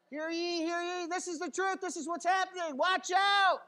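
high, loud proclamation building to a shout; hollered crier's announcement; town crier. Acted. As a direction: herald the news to everyone. Acts out town crier again.

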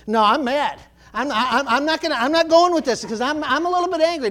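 mildly angry inner protest, retold; mock mad. Churchgoer inwardly resisting the preaching, mock mad. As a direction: dig in, half-heartedly cross. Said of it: "I was kinda grumpy and I was kinda mad".

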